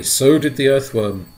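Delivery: ordinary delivery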